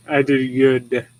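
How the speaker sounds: slow, smug dog voice; proud dog voice. Retold or imitated. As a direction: voice the pleased dog, drawing out each word slowly. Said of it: "He's like".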